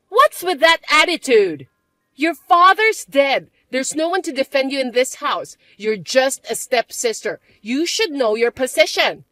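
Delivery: a spoiled stepsister barking demands; bratty stepsister. Acted. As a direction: stepsister sneering at her older sister.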